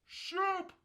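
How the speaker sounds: high, stretched-out holler from far off; mock a father bellowing from the hilltop; drawn-out imitation shouting, each word pushed hard; dad's sideline yell. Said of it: "you can hear my dad up at the top of the hill".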